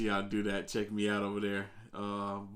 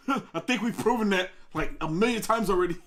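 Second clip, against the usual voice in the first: talking through laughter, high and loud; amused, words bursting out between laughs; laughing; 10 semitones higher, 5 dB louder than usual. Real, not acted. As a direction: crack up while answering. Laughs at a viewer's comment.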